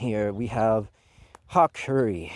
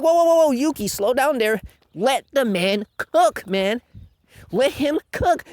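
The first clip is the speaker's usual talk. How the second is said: higher-pitched, pleading mock fanboy; excited fan impression; 11 semitones higher, 4 dB louder than usual. Fans telling the critic to hold on, mock-pleading. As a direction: urge patience like an over-invested fan. Voicing fans urging patience.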